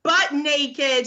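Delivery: usual speaking voice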